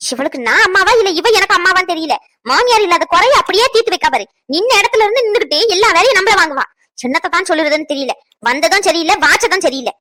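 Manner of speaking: loud, irritated complaining, fast in places; loud grumbling. Acted. Daughter-in-law griping about her bossy mother-in-law, loud and irritated. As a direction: vent your annoyance out loud.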